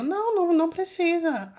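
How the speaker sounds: high, loud nervous no; nervous refusal. Retold or imitated. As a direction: refuse him in a high anxious voice. Ela recusa nervosa no sonho. (recusa aguda e nervosa)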